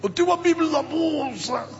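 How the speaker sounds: high, strained groan of an injured man; groaning in pain. Retold or imitated. Injured pastor groaning to the stranger, high strained voice.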